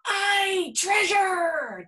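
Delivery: high, eager crew shout; pirate crew shouting. A narrator's reading. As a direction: cheer for the treasure. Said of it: "the others shouted".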